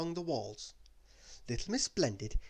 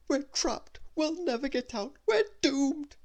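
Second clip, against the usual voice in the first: much higher, rising louder fretful wail; panicky; 13 semitones higher, 5 dB louder than usual. Acted. Mister Worry panicking in the pyramid, high rising wail. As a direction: fret faster and louder toward doom.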